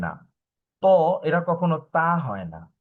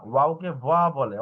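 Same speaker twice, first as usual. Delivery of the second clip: ordinary delivery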